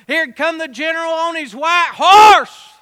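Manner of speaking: loud, rising proclamation; excited shout. Acted. Preacher describing the general arriving, loud excited shout. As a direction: cry it out like the troops cheering.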